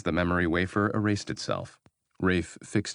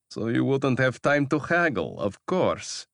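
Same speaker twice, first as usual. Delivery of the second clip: higher, pleased with himself; smug gloating; 7 semitones higher, 3 dB louder than usual. Acted. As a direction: gloat over the deal. Baylor flashes teeth, gloats.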